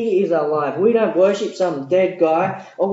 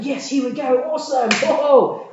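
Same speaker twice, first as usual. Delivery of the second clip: mock eagerness; 9 semitones higher, 4 dB louder than usual.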